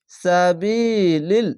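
সাবিলিল recited very slowly, the বি drawn out for one alif; slow chanted recitation; long vowel. Shown as a demonstration. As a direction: draw out the ই in সাবিলিল. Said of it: "জেরের পাশে ইয়া সাকিন এক আলিফ টেনে পড়বেন".

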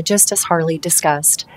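ordinary delivery

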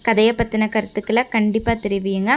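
ordinary delivery, nothing marked

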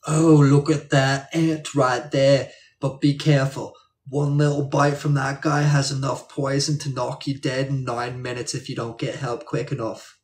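hammed-up Aussie nature-show host, loud at first; excited Australian TV presenter impression; Australian accent. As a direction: narrate a deadly-creature show in a put-on Australian accent. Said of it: "That's your best accent".

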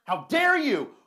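high, loud indignant cry; mock outrage. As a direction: throw the words back in mock offense.

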